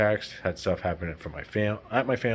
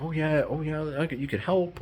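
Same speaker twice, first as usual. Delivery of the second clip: higher, eager offer to help; eager empath; 9 semitones higher than usual. Retold or imitated. The kind empath offering help, much higher and eager. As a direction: rush to please. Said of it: "you're just like".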